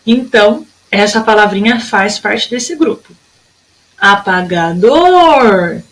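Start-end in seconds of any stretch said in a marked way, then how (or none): 4.0-5.8 "Apagador" slowly, with a long held "dor"; slow "Apagador", swelling on "dor"; stretched last syllable. Shown as a demonstration. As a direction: draw out the final "dor" of "Apagador". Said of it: "a sílaba que mais durou tempo".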